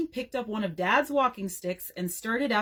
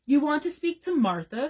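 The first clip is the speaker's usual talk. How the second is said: higher-pitched, incredulous question; 6 semitones higher, 3 dB louder than usual. A narrator's reading. Brother answering his sister's phone caller, incredulous. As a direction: ask in disbelief. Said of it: "in an incredulous voice".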